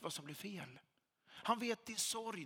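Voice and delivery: usual speaking voice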